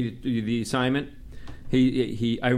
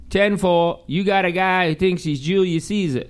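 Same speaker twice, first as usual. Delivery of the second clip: high, louder dismissive radio dispatcher; 5 semitones higher, 4 dB louder than usual. Retold or imitated. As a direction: play the clueless dispatcher on the radio. Radio dispatcher answering his call.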